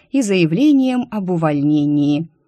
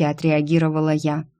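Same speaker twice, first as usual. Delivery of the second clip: usual speaking voice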